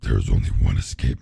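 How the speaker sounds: very low booming demon; demonic voice. Acted. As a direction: drop deep into a demon's boom. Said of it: "There's a better voice for that".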